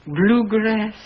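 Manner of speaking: ordinary talk, nothing marked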